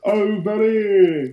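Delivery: high, slow, drawn-out "Oh, buddy"; creepy high drawn-out call. Retold or imitated. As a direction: call him over like a creep. Creepy man beckoning you over.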